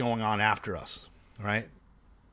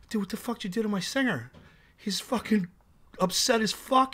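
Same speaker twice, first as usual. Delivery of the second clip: upset manager; 9 semitones higher than usual. Retold or imitated. Quoting the angry tour manager.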